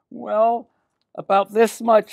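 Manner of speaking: put-on high, hesitant little-girl voice; Millie. Millie shows the man her purse, high girlish voice. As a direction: pitch it up with a pause after the first word. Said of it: "she said".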